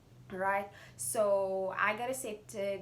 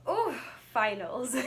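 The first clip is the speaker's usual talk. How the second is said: laughing; 4 semitones higher, 6 dB louder than usual. Real, not acted. Dreading finals, laughing.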